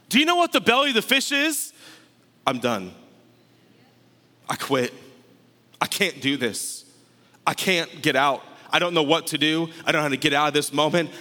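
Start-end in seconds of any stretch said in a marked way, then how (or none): 2.5-11.1 giving up. Acted. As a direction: voice someone at the end of their strength.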